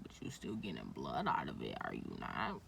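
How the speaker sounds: a deep mock-serious aside; mock low voice. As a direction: drop the voice way down for a dry retort. Her retort voiced very low.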